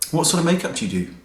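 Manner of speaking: high, fast, sniffy question; mildly hammed-up snooty guy; snooty guy impression. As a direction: imitate his condescending tone. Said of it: "Like that".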